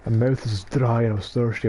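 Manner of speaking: ordinary talk, nothing marked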